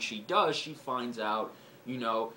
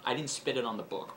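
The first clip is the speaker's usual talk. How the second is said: ordinary talk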